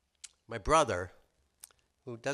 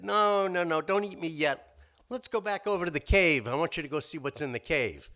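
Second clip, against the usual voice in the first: high, louder urgent pleading; pleading rabbit; 6 semitones higher, 7 dB louder than usual. Acted. Rabbit begging the hyena not to eat him yet. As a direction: hurry to talk the hyena into the cave.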